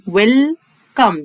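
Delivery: put-on slow, drawn-out 웰컴 for the children; ويلكم said slowly, wel-kam, silent final e; slow teaching model. Shown as a demonstration. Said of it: "بص كده".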